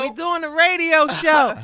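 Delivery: high, loud, drawn-out announcement; real yelling. Real, not acted. Host reminding the crew they are on air, yelling. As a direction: yell it out slowly to the room. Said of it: "I just like yelling".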